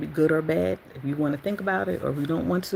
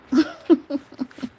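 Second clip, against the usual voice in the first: sudden high laugh; burst of laughter; 12 semitones higher, 6 dB louder than usual. Real, not acted. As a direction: let out a bright laugh. Laughs about beauty sleep.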